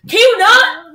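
loud, high, indignant question; real irritated outburst; exasperated yell. Real, not acted. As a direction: shout it at someone bothering you. Snaps at interruption while recording.

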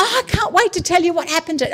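high, very fast gush; excited. Acted. Child running in to tell Father news, excited. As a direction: rush it out, bursting to tell. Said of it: "but be excited, run in".

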